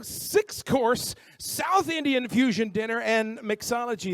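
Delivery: normal talking voice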